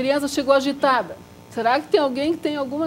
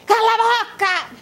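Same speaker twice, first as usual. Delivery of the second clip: much higher and louder mock shout, slow; imitated teacher's yell; 11 semitones higher, 6 dB louder, slower than usual. Imitating a math teacher yelling at the class. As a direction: bark it like a fed-up math teacher. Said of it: "não precisava gritar".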